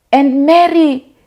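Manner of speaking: mock school narrator reading the drama. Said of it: "I'll be like".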